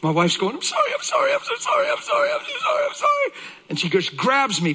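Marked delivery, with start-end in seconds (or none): 0.5-3.3 high-pitched imitation of a frantic, pleading wife; frantic apology. Wife running to her husband, frantic apologizing. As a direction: gush apologies over and over, very high.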